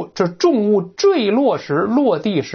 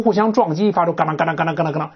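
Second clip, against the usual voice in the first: rapid 嘎当 clatter noises; imitated clatter; faster than usual. Shown as a demonstration. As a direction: rattle it off like hooves.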